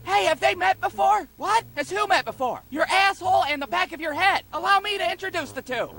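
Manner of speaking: high-pitched, showy wrestler banter; mock taunting. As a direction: set up the insult like a grand introduction. Acted mock introduction wrestling taunt.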